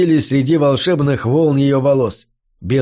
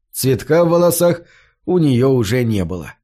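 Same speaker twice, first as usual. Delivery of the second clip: ordinary delivery, nothing marked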